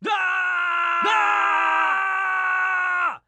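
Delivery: high short screams; frightened cries. Acted. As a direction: cry out as the blast hits. Victims hit by the power.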